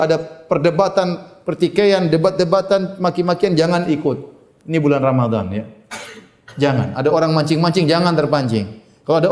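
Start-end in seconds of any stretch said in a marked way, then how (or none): none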